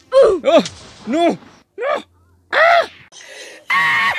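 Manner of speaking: sudden loud yells of frustration; frustrated yell. Real, not acted. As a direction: shout as you lose. Fails the level, yells.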